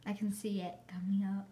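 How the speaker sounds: hushed whisper to camera. Real, not acted. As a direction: whisper it so dad won't hear. Narrating dad's reaction.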